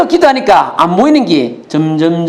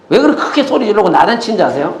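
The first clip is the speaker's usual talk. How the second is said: ordinary talk, nothing marked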